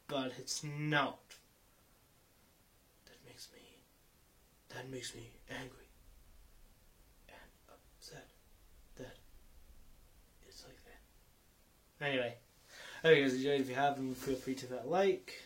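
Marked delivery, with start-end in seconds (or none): 3.0-12.0 barely audible, halting whisper; hushed mock upset. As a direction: whisper your annoyance, trailing into silence. Mock-upset that the advice was good.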